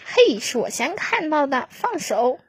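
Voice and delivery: loud, high quarrelsome shout; snatch it back, arguing loudly; loud childish quarrel; squabbling. Acted. Chicks squabbling over a find.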